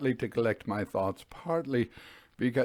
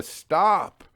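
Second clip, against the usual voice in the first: a loud, higher call of stop; shout; 6 semitones higher, 5 dB louder than usual. Acted. Signalman recalls signalling the driver, a sudden acted shout. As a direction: yell it out to the driver.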